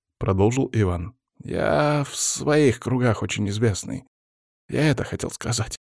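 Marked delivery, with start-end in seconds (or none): 1.4-5.7 higher-pitched flustered mumble; flustered self-justification; muttering. A narrator's reading. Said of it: "забормотал Вяземский".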